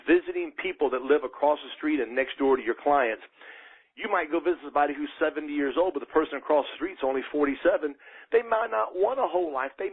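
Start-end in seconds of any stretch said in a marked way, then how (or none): none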